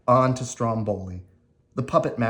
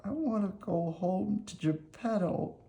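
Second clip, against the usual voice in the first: put-on high, soft, wistful puppet-boy voice; quietly longing for home, high; homesick Pinocchio; 10 semitones higher, 7 dB quieter than usual. Pinocchio wishing to go home to his father, soft and sad. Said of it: "Pinocchio was very unhappy".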